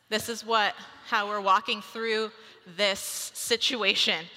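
usual speaking voice

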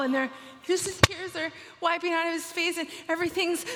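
real tears, voice pushed high and tight; choked up. Real, not acted. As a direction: fight back tears as you tell it. Speaker moved retelling the scene.

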